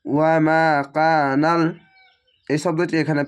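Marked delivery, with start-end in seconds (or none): 0.1-1.6 ওয়া মা কানাল drawn out slowly, vowels held one alif; slow chanted ওয়া মা কানাল; Quranic recitation. Shown as a demonstration. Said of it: "এক আলিফ টেনে পড়বেন".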